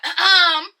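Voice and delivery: loud, exaggerated "ahem"; imitated throat-clearing. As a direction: pointedly clear your throat, mockingly. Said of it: "She was like".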